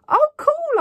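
very high, loud burst of enthusiasm; overexcited. A narrator's reading. Girl trying to get her friend psyched up, overexcited. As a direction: squeal it, overdoing the excitement. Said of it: "maybe a little overexcited".

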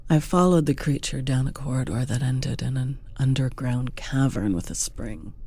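ordinary delivery, nothing marked

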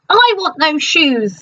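slow, greedy murmur; greedy witch. A narrator's reading. Witch coveting Dorothy's shoes, slow and greedy. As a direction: savour the wish slowly. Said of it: "thought the witch".

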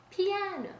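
piano said softly, the Italian way, pee-AH-no. Shown as a demonstration. Teacher saying the Italian word piano softly to show soft. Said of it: "we say".

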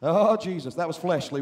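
ordinary talk, nothing marked

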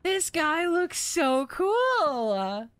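high, slow amazed whoa. Real, not acted. Player awed by the skeleton, high and drawn out. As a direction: marvel at the cool skeleton.

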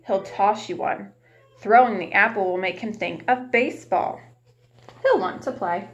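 plain reading voice

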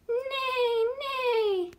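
high whinnying neigh like a horse; playful high horse neigh. Shown as a demonstration. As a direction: neigh high like a pony for the kids. Teacher voicing the horse.

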